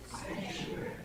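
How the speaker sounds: sanctuary whispered softly, no voice. Shown as a demonstration. Storyteller practicing the quiet word with kids. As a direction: breathe out sanctuary in a hush. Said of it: "quietly say the word sanctuary".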